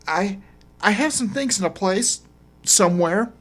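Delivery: high, faltering woman's voice; lightly voiced hesitant woman. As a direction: stumble for an answer. Woman stammers, evasive.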